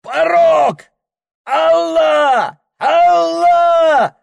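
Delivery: high desperate yelling; terrified shout. Acted. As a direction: cry out to God in terror. Circassian screams at the rapids. (отчаянный крик)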